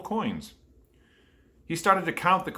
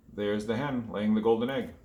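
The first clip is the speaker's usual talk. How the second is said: usual speaking voice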